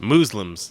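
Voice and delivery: "Muslims" as "MOOZ-lums", punched; mock mispronunciation. Host echoing the speaker in the clip, mock "MOOZ-lums" pronunciation. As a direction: stress a long oo in "Muslims". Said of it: "is the correct pronunciation".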